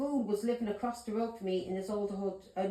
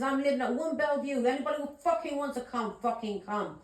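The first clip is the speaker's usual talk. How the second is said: loud, higher-pitched retold challenge; raised voice, daring anyone to come; retold shout; 6 semitones higher, 4 dB louder than usual. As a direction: re-enact shouting out a defiant dare. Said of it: "I was shouting that".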